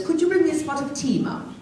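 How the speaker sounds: high, quick mock-royal English; highbrow English accent. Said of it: "highbrow like the Queen".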